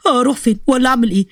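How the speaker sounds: plain reading voice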